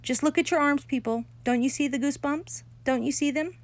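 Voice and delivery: put-on a bragging girl urging the class to look; insistent showing-off. A narrator's reading. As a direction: demand they see it. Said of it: "she said".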